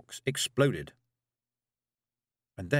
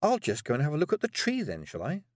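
high, sarcastic sing-out; withering sarcasm; 7 semitones higher than usual. A narrator's reading. Girl sent off to look at a tree, sarcastic. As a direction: lay on the sarcasm with raised pitch. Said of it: "she said with what she hoped was withering sarcasm".

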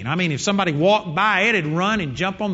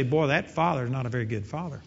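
quiet aside; 6 semitones lower, 7 dB quieter than usual. Pretend. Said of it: "You'd say".

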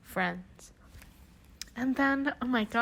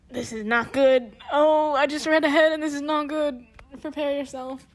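real alarm; 5 semitones higher, 8 dB louder than usual. Real, not acted. Reader alarmed after reading ahead.

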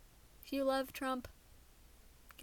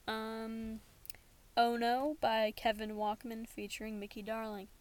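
ordinary talk

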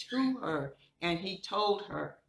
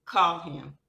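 commanding; 8 dB louder than usual. Retold or imitated. Jesus commanding the disciples.

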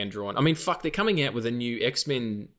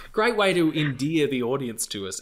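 normal talking voice

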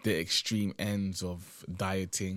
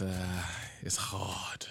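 whispered admission; 6 dB quieter, much slower than usual. Real, not acted. Struggle with diet.

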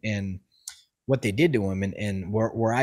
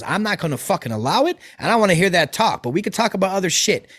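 raised, louder voice, stern and heated; angry scolding; 6 semitones higher, 3 dB louder than usual. Retold or imitated. Driver pulls over and scolds the passenger, heated and loud. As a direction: chew him out like a furious soldier.

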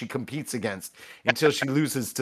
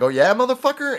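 high, loud tough-guy challenge; mock threat; 14 semitones higher, 5 dB louder than usual. Buddy raising a club at a mugger, high loud challenge. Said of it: "He's like".

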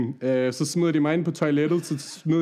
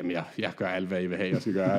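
much lower, much softer pleading; meek submission; 8 semitones lower, 6 dB quieter than usual. Retold or imitated. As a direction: beg meekly, almost under the breath. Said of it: "Så siger jeg".

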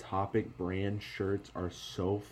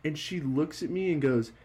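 ordinary talk

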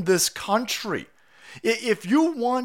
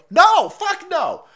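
emphatic no; 9 semitones higher, 5 dB louder, much slower than usual. Real, not acted. Rejecting the parent's thinking.

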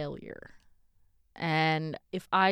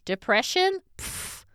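mocking disbelief; 12 semitones higher, 4 dB louder than usual. Mocking the pastor's dismissal.

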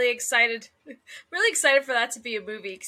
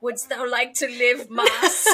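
meme quote; 4 dB louder, slower than usual. Retold or imitated. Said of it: "they're like".

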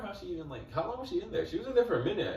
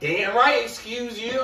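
high-pitched, indignant comeback; mock rude retort; 8 semitones higher, 4 dB louder, slower than usual. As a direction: imitate a pushy stranger putting someone down. Retold rude reply from festival crowd.

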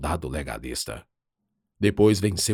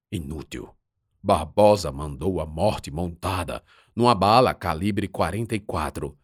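ordinary delivery

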